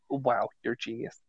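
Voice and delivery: quiet, underplayed sarcastic amazement; mock awe. Americans marvelling at whoever named the sidewalk, mock awe. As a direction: murmur it in hushed fake wonder. Acted reply to inventor of sidewalk.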